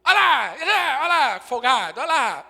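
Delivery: loud mocking scold of the husband; nagging wife. Wife loudly mocking her husband at the family party. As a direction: jab at the folgado husband, loud and scornful. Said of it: "fica criticando o marido pra todo mundo".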